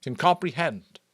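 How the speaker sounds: solemn elder's public proclamation; chief elder's address. Acted. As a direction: speak up to the whole community with ceremony.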